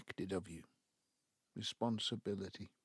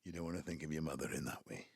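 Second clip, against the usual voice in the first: usual speaking voice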